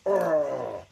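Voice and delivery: short loud play shout for the toy; hammed-up action-figure yell; mock yell. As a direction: bark out a toy's cry. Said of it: "And he yells".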